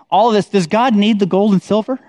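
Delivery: usual speaking voice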